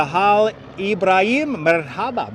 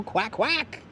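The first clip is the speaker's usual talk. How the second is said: higher, quieter playful quacking; light mock duck call; 4 semitones higher, 7 dB quieter than usual. Imitating a duck.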